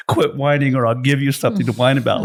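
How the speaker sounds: lightly voiced parent warning the kids; parents' scolding. Speaker quoting parents to co-hosts, mock parental warning. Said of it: "like our parents used to say".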